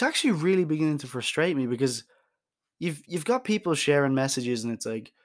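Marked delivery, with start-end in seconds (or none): none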